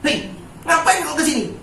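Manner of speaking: high-pitched mock scolding; hypothetical angry rebuke, pitched high; mock angry God. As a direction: pretend God snapping at the devil. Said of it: "terus Tuhan marah-marah?".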